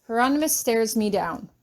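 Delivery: plain reading voice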